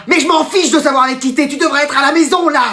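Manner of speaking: high-pitched, exasperated telling-off; scolding mother. Acted. Mother phones late son, exasperated scolding.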